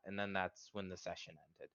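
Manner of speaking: ordinary talk, nothing marked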